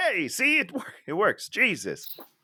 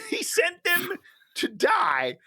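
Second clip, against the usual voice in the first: incredulous rant; slower than usual. Real, not acted. Ranting about the movie.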